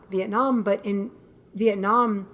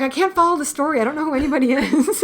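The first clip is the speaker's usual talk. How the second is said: mock mom voice, higher and faster; higher, quick mom impression; imitating mom; 6 semitones higher, 4 dB louder, faster than usual. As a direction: play the confused mom complaining.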